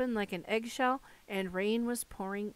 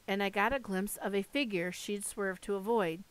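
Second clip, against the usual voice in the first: usual speaking voice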